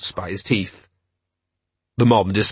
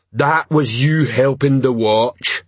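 slow, ponderous troll voice; slower than usual. Acted. Troll answering the man under his feet, ponderous.

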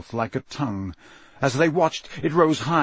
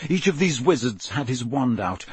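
ordinary delivery

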